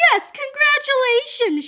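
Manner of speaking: high, bright mock shout; high excited announcement. A narrator's reading. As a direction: squeal it out as the eager Miss Anthrope. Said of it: "shouted Miss Anthrope".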